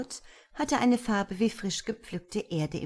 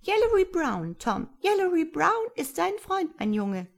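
high, chirping little creature; friendly creature; 12 semitones higher, 4 dB louder than usual. A narrator's reading. Tiny creature reassuring frightened Tom, high and chirpy. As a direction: reassure him in a tiny birdlike voice.

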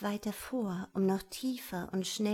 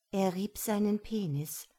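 usual speaking voice

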